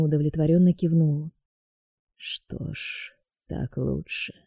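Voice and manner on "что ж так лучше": soft, slow menacing voice; quiet sinister deity; dark god voice. A narrator's reading.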